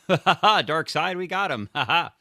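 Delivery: high, loud villain cackle and gloat; mustache twirling villain. Retold or imitated. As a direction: ham up a cartoon villain's gloating laugh. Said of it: "he's like the mustache twirling".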